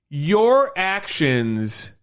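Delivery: each word drawn out slowly to make the point; slow emphasis. Real, not acted. Arguing the movie's moral, slow and deliberate.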